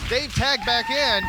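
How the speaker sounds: ordinary talk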